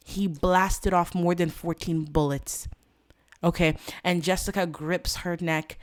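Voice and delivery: ordinary talk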